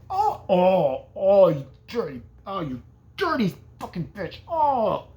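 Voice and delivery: loud, groaning curse with a laugh; hurt furious old woman. Retold or imitated. Old woman hit with the poker, loud pained cursing. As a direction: ham up the pain and rage. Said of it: "She went".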